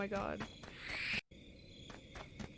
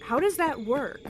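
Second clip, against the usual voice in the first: higher, louder repeated question; baffled insistence; 4 semitones higher, 4 dB louder than usual. Real, not acted. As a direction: ask it again, more insistently baffled.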